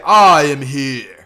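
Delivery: loud, drawn-out heroic announcement; booming hero entrance. Retold or imitated. All Might greets the students on arrival, booming. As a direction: belt it out slowly like an arriving hero.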